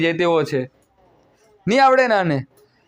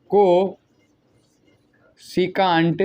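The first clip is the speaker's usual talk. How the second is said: "કોસિકાન્ટ" stretched out very slowly, each syllable held; drawn-out word; much slower than usual. Shown as a demonstration. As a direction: spell it out slowly for pupils. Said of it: "stretched teaching word".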